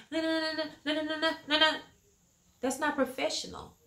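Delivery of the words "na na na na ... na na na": higher, sassy mocking na-na; mock attitude. Woman mocking how she used to talk at work. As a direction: imitate a rude snappy tone. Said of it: "There's no reason for me to be".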